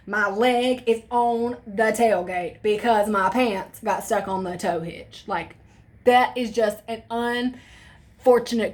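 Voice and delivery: slow, prim explaining; over-formal excuse. Retold or imitated. Teen on the ground slowly explains to the cop, mock-dignified. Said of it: "I was like".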